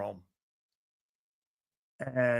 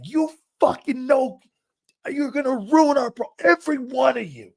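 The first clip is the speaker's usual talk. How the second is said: high, strained imitation of a raging coach; coach hollering; 10 semitones higher, 3 dB louder than usual. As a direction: tear into the whole team like their coach. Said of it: "I'd never been hollered like that".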